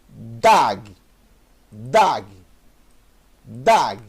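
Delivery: Dag said slowly and clearly; slow model reading. Shown as a demonstration. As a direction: sound out Dag carefully. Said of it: "Olha só como é fácil". (Dag pronunciado devagar e claro)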